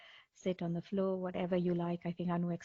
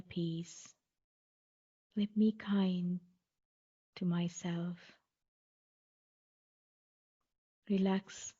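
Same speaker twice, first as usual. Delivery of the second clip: guided meditation. Acted. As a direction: guide listeners calmly, phrase by phrase, leaving space between. Leads a guided mindfulness body scan.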